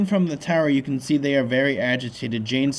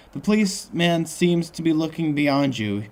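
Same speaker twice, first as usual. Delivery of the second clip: ordinary delivery, nothing marked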